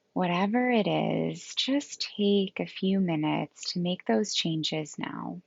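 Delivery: calm yoga nidra guidance with long, restful pauses; guided meditation. Acted. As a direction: gently lead them into rest, leaving space between phrases.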